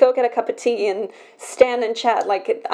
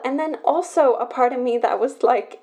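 normal talking voice

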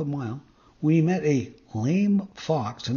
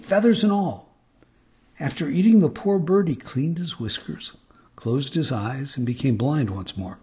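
ordinary delivery

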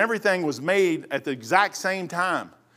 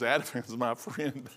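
lightly voiced mother, much softer; soft mom voice; 7 semitones lower, 10 dB quieter, slower than usual. Mother quietly begging her son over her pills, softer. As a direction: murmur it like a pleading old mom.

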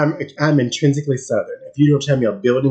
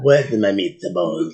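put-on low, slow Italian-accented voice; mock Italian accent; 6 semitones lower, much slower than usual. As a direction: ham up an Italian accent, slowly. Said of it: "you said it with an Italian accent".

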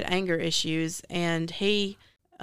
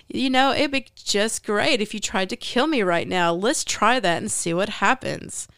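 put-on breezy, fake-jovial voice, a bit higher; actress's character voice; 3 semitones higher than usual. Daring them to kill her, imitated in a fake-jovial voice. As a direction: imitate a falsely cheerful, unbothered powerful being, stretching the ending. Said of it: "You wanna try to do that voice?".

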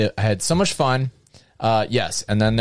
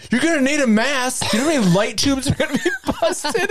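much higher, strained retelling of a friend; incredulous mock warning. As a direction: imitate an incredulous friend in a high-pitched voice.

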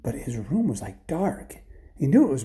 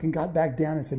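ordinary delivery, nothing marked

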